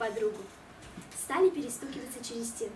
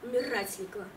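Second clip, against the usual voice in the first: sulky mumble; 6 semitones lower than usual. Acted. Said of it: "буркнула я".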